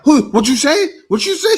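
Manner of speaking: loud, high, aggressive mock shout; mock angry yell. Man yelling at the woman, loud mock confrontation.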